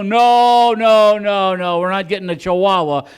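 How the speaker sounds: husband loudly refusing to buy a puppy; firm refusal. Retold or imitated. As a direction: shut it down hard. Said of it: "I go".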